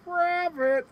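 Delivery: high sing-song call of Rabbit. Retold or imitated. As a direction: call across the hall to your daughter. Mom calls across Constitution Hall.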